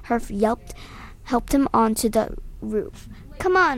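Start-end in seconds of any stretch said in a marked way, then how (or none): 3.4-3.8 high, loud call; yell. A narrator's reading. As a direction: shout to run. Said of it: "I yelled".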